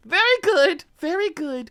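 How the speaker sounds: put-on high, loud kid's voice; mock little girl praising herself; child's voice. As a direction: squeal it like a delighted little girl. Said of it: "cracks them open and goes".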